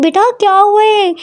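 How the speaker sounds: high, anxious mother's voice; a mother fretting over her daughter; worried mother. Acted. Mother asks her crying daughter what happened, high and anxious.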